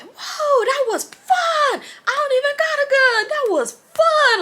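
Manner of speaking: loud, very high whooping voice; wildly excited friend. Retold or imitated. Drunk friend hopping in the car after a fight, whooping. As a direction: imitate a hyped-up friend, stretching the key words.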